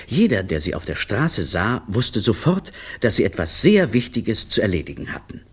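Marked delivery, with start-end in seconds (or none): none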